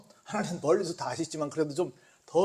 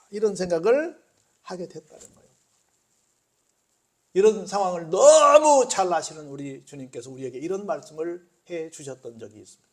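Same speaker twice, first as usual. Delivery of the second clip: usual speaking voice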